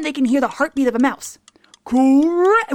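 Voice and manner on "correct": high, loud "Correct!"; excited host. Acted. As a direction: cheer it like a quiz host. Quiz host answer.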